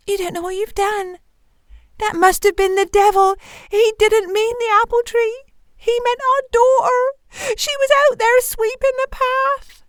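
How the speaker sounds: very high, mounting dismay; acted anguish, pitch climbing to the end; horrified wife. A narrator's reading. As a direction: build from hushed dread to high wail. Wife realises daughter was given away.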